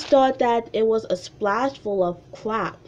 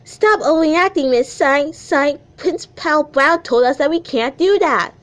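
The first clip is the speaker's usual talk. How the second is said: put-on a kid telling the teacher off, pitched up; high Darling voice; 5 semitones higher than usual. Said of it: "to sound like this high".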